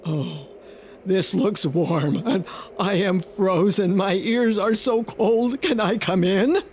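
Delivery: a big frozen wolf asking politely; cold wolf. Acted. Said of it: "and he said".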